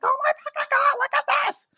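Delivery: very high, squealing with delight; excited squeal. Retold or imitated. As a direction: shriek it out like good news just landed.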